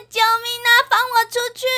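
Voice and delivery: high, panicky cry for help; calling for help. Acted. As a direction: yell to be let out. 被困电梯的人呼救. (惊慌地高声呼救)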